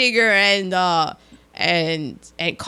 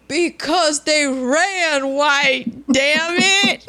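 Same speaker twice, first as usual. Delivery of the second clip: high, slow, wailing retold answer; high, slow mock-sob line; crying junkie; 5 semitones higher, slower than usual. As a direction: wail it out like a junkie in tears.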